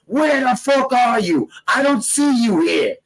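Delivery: high, put-on voice of a lost, angry guy; mock angry caller. Two angry guys hunting each other on Fulton Street, mock yelling. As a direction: play the guy pacing the street, hammed-up. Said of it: "two angry guys pacing back and forth going".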